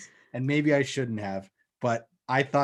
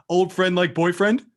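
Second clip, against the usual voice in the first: slightly raised, pointed question; mock subtle line; 5 semitones higher, 7 dB louder than usual. Imitating him asking about her old friend, with a pointed rise. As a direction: tease her with a knowing little lift. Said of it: "he very subtly goes".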